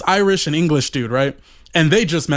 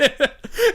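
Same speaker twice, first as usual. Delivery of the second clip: high laugh. Real, not acted. Laughing at his own story.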